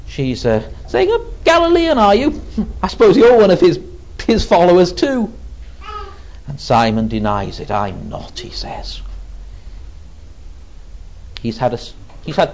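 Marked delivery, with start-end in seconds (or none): 1.1-5.3 high, loud mocking taunt; teasing. Servant girl teasing Peter about his Galilean accent, mocking. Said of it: "She's teasing him". 7.7-8.4 short, low, softened denial. Retold or imitated. Peter denying the girl's accusation, quiet.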